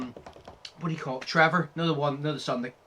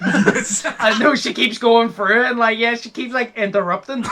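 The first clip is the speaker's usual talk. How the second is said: higher, louder, slow, laughing speech; 7 semitones higher, 7 dB louder than usual. Real, not acted. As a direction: talk while you're cracking up. Laughing while retelling scene.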